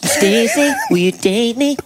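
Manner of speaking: high comic voice. Pretend. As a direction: ask in a squeaky goofy voice. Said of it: "You sound like Aziz Ansari".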